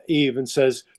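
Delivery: ordinary talk, nothing marked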